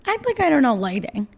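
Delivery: usual speaking voice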